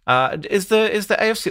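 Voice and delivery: put-on British newsreader accent; mock BBC presenter, English accent. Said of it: "when that accent is so good".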